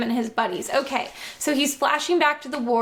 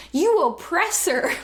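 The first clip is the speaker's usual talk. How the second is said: screamed; 5 semitones higher than usual. A narrator's reading. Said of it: "she screamed".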